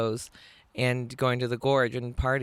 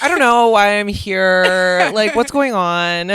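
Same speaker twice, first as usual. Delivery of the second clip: loud, slow mock cluelessness; mock coy; 5 semitones higher, 9 dB louder, slower than usual. Receptionist sneaking in to see cats, hammed-up coy. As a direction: play it coy, drawn out and a little higher. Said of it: "she was coy about it".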